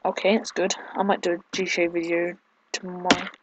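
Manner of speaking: normal talking voice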